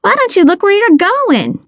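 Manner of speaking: high-pitched, exasperated demand; acted outburst at a careless stranger; indignant shout. A narrator's reading. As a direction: raise the pitch sharply, scolding without real volume. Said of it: "I shouted".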